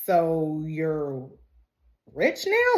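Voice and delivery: pause, then pitch up into the question; intrigued realization. Retold or imitated. Fiancée hearing he is in the will, suddenly intrigued.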